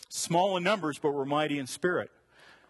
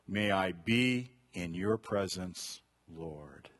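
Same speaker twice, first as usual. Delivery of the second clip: soft, slow prayerful line; hushed slow prayer; quiet prayer; 8 semitones lower, 5 dB quieter, slower than usual. Acted. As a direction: pray it gently. Reading a prayer.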